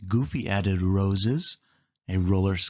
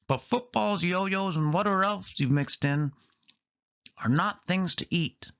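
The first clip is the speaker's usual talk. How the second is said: Donald voice; 9 semitones higher than usual. Acted. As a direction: list them off disapprovingly. Donald rejects Goofy's soup.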